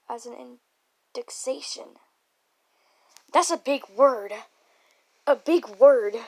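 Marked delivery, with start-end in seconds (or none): 3.3-6.2 loud, bouncy exclamation with swooping stresses; loud mock outburst. Reader stumbling over a long word, loud mock amazement. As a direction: hammer each beat in mock amazement.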